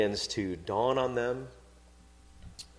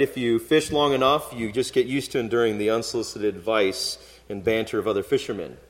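ordinary talk, nothing marked

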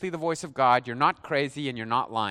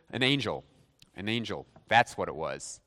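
settled explanation; 10 semitones lower, 3 dB quieter than usual. Acted. Voices people settling on an explanation.

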